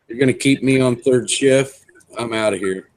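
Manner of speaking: ordinary talk, nothing marked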